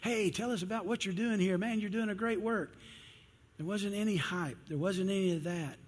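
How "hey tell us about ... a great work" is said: eager reporter. Retold or imitated.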